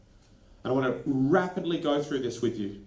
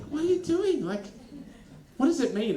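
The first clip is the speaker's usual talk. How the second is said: baffled; 11 semitones higher than usual. Retold or imitated. Baffled reply to British greeting.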